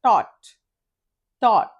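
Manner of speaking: "thought" said like T-O-T; pronounced as T-O-T. Shown as a demonstration. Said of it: "దీన్ని ఎలా పలకాలి?". ("thought" టీ ఓ టీగా పలకడం)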